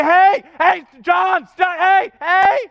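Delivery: high, loud repeated shouts; urgent yell. Acted. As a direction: shout to stop a child in danger. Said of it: "You're gonna yell, you're gonna scream".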